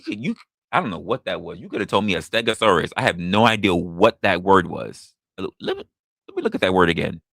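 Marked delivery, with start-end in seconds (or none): none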